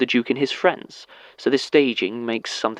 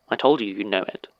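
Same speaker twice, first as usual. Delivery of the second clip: usual speaking voice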